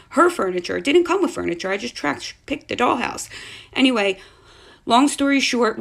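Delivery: ordinary delivery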